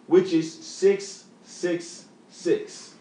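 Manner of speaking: slow dramatic reveal. Real, not acted.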